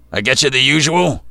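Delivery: gruff bartender, grumbled loud and high; gravelly voice. A narrator's reading. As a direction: grumble it like a rough old barkeep. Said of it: "His gravelly voice".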